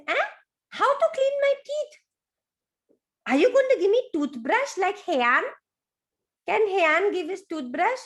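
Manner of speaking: put-on high, surprised child voice; high amazed baby croc; surprised baby crocodile. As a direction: squeak out the astonished question.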